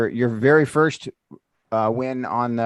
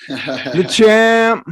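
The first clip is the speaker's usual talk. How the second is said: excited greeting; 9 semitones higher, 8 dB louder than usual. Real, not acted. Hails winner arriving.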